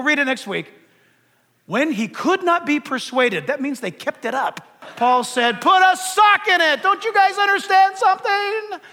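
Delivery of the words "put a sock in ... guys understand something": high-pitched, acted exasperation; mock an exasperated rebuke, pitched up; exasperated retort. Paul telling the pleading believers to stop, exasperated.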